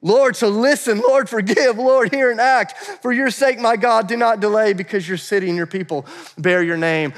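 loud, high-pitched pleading cry to God; rising preacher's shout of petition; shouted prayer. Acted. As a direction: call out the prayer with full force. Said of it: "Shout it out".

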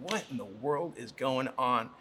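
perplexed. Acted. Peter puzzles over his vision, quietly perplexed. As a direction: think it aloud, mildly baffled. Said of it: "he was inwardly perplexed".

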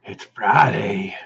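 low, slow spooky drawl; creepy voice. Pretend. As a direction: say it like a horror movie host. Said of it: "Friday gets the creepy voice".

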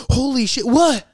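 high, loud startled outburst; startled exclamation. Retold or imitated. Storyteller re-enacts reacting to oncoming headlights, startled. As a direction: blurt it out in sudden alarm. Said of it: "Like, I literally go".

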